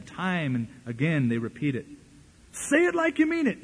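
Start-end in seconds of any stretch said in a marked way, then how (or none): 2.5-3.6 much higher, urgent rally call; mock preacher yell. Preacher pushing the crowd to mean it, yelling. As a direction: demand they mean it. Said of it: "Now he's yelling".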